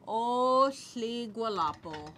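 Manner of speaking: O hli gualapo drawn out slowly as one phrase; a slow respectful greeting to the crowd; slow full greeting. Shown as a demonstration. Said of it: "So this first part".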